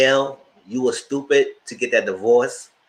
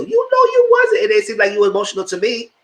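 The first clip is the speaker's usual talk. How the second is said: high, loud sarcastic mock voice; mock voice pitched way up; 9 semitones higher, 3 dB louder than usual. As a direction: scoff at his claim in a high squeal. Mocking the husband's claimed emotion.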